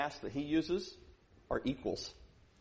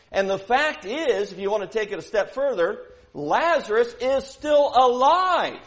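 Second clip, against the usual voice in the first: loud and high, rising to a shout; preaching at full force; 7 semitones higher, 12 dB louder than usual. Acted. Preacher driving home his point to the church, loud and rising. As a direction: hammer each word at the congregation.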